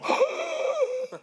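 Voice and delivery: high breathy gasp of amazed joy; delighted gasp. Retold or imitated. Hearing the prophecy again, he gasps high in delight. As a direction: gasp as if the news just hit you. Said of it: "and I go".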